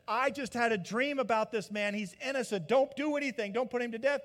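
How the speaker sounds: a wife warning her husband not to kill an innocent man; pleading wife. Retold or imitated. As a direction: beg him to spare the man. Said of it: "and she said".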